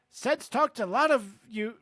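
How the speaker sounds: put-on hearty British-accented proud dad; boastful English father. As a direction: brag about your son, British accent. Reads Amos's dialogue in character.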